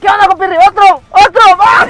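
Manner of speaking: grito agudo y fuerte de retador; reto a gritos. Retold or imitated. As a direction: pide otra ronda a gritos, actuado. Recrea el reto entre golpes. (desafío gritado en plena pelea recreada)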